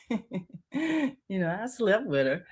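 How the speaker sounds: lightly acted smug confession; imitated taunt. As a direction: toss it out as a taunt after a chuckle. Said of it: "kind of thrown in my face".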